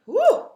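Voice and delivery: high, loud woo of delight; joyful whoop. Real, not acted. Coach whoops with delight mid-meditation.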